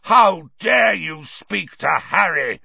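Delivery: slow, loud, outraged roar; furious, drawn-out bellow. A narrator's reading.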